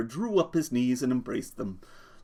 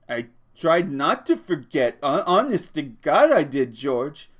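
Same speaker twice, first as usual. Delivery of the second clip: pleading; 4 semitones higher, 6 dB louder than usual. Acted. Lenny pleading earnestly.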